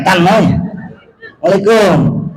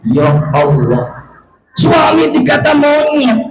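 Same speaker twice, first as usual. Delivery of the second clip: ordinary talk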